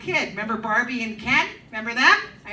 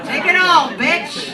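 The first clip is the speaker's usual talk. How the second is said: shouted punchline; 5 semitones higher, 9 dB louder, much slower than usual. Acted. Punchline yelled.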